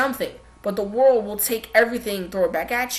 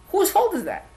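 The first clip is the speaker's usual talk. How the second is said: higher, sharp challenging ask; raised, accusing rhetorical question; pointed question; 8 semitones higher than usual. Acted. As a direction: throw the question at them.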